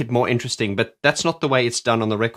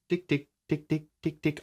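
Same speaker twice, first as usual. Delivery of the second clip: soft tick imitation; 4 semitones higher, 7 dB quieter than usual. Shown as a demonstration. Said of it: "so subtle".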